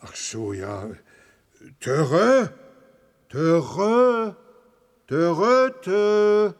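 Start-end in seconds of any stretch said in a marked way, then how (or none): 1.8-6.5 high, loud trumpeting elephant sounds; talking to the sick elephant in elephant language, high and loud. Acted. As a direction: call out like a friendly elephant. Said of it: "so versteht er dich doch nicht".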